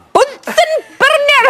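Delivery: slow, high attempt at a Scottish accent; lightly voiced Scottish on Bunsen burner. Host attempting a Scottish accent, then admitting she's bad at them. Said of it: "I'm not very good at accents".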